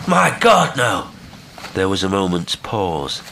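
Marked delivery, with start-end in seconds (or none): 0.1-1.1 high, loud cry falling away; emphatic, impassioned outcry; emphatic refusal. A narrator's reading. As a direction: cry out in protest, then come down. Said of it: "with extraordinary emphasis".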